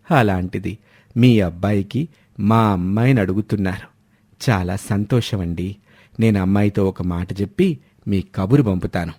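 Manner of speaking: put-on low, measured older father's voice; Venkatrao replying. Father Venkatrao answering wealthy relatives, low, calm and courteous. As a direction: speak as a low-voiced father politely accepting a proposal. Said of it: "అప్పుడు వెంకట్రావు బదిలిస్తూ".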